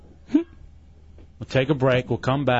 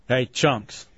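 ordinary delivery

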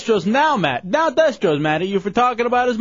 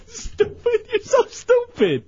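laughing; 12 semitones higher, slower than usual. Real, not acted. Host laughing at caller's joke.

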